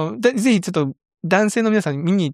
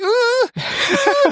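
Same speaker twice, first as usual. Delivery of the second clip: mock whimpering; much slower than usual. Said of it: "って二人でさ".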